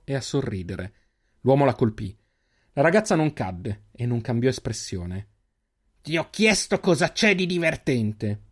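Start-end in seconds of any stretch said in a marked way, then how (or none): 6.0-8.4 slow, high threatening demand; menacing repeat. Acted. Extris leader demanding an answer after striking her, slow menacing voice. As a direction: lean on each word, menacing.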